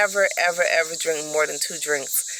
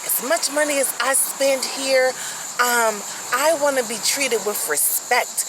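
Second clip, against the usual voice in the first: imitating his demanding rant, higher pitched; mock-outraged, raised pitch; retold indignant outburst; 7 semitones higher than usual. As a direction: act out an entitled customer going off. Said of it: "he went off. He was like".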